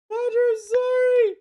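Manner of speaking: very high falsetto scream; piercing, loud falsetto plea; screamed mock apology. Real, not acted. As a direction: shriek an over-the-top apology. Louder repeat of mock apology.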